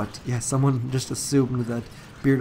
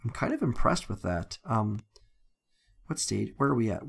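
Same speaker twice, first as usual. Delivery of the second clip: ordinary talk, nothing marked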